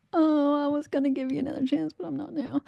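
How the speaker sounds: sweetly dismissing the rude boss; sarcastic sweet retort. Retold or imitated.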